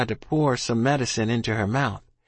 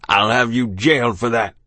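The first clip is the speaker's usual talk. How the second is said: loud, hoarse threat; 5 dB louder than usual. A narrator's reading. Beaten captain threatening his attacker, hoarse. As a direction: threaten forcefully through a hoarse throat. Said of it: "said in a hoarse voice".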